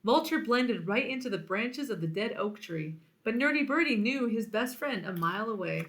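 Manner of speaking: ordinary delivery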